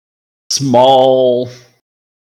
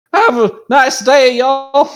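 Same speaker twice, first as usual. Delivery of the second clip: put-on high-pitched hillbilly accent; 13 semitones higher, 4 dB louder, slower than usual. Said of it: "He needs to sound like a hillbilly".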